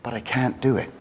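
fading to near silence, very slow; trailing off, barely voiced; hushed despair. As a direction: let it die away, hopeless. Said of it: "this is what he said".

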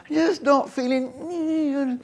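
high, drawn-out feeble whine; feeling off. Acted. Preacher acting out a body not working, mock feeble whine. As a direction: stretch it into a soft mock moan. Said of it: "things just aren't working right".

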